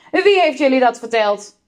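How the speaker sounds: lightly voiced strict schoolmaster; stern demand. A narrator's reading. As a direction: question the children sternly. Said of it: "riep de meester streng".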